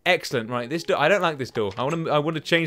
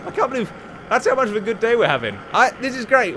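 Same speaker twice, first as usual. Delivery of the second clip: excited; 8 semitones higher, 5 dB louder than usual. Real, not acted. Celebrating a kill.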